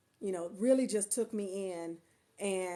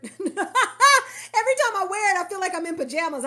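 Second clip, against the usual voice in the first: high, loud laughing words; 8 semitones higher, 9 dB louder than usual. Real, not acted. Laughing about shirt.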